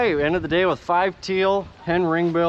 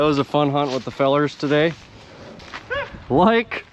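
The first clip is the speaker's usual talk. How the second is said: ordinary talk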